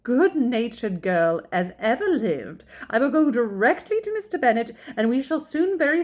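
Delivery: high, flustered mother making excuses; agitated matchmaking mother; alarmed. A narrator's reading. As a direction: hurry to reassure, pitch jumping up.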